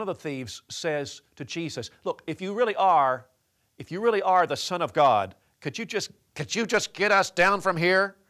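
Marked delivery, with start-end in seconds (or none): 2.0-8.2 loud, higher, sneering challenge; mocking tone. Thief on the cross taunting Jesus, loud mockery. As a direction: taunt him, daring him to prove it. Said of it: "it was in a mocking tone".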